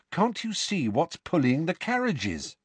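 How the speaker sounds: incredulous. Acted. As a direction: press it in disbelief. Harry disbelieving.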